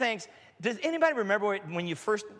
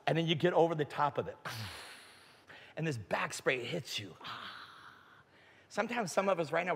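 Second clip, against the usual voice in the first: ordinary talk, nothing marked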